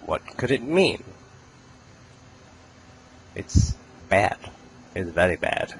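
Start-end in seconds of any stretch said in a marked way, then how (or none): none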